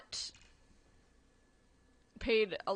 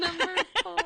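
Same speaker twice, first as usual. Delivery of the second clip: higher, laughing repeat; laughing echo; 8 semitones higher, 5 dB louder than usual. Real, not acted. Friend laughing along at the kid's role, higher laughing echo. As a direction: laugh it back in amusement.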